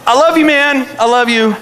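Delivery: high, loud mock declaration of love; strained affection. Mentor to the kid who blamed him, straining to love loudly.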